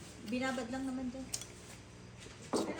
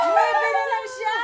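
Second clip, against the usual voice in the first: high, loud, drawn-out wail with laughter; laughing, high-pitched shriek over the cards; excited outcry; 13 semitones higher, 11 dB louder, slower than usual. Real, not acted. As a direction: cry it out slowly at full voice. Excited outcry during card game.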